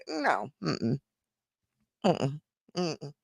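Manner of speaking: quiet, dismissive mm-mm; hushed firm no; soft refusal. Real, not acted. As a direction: shake it off under your breath. Refusing auto pay firmly.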